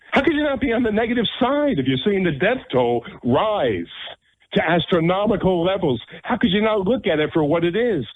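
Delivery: high-pitched, incredulous rhetorical rant; exasperated. Real, not acted. Host defending the mayor to doubting listeners, exasperated and high-pitched. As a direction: push the pitch way up in real exasperation.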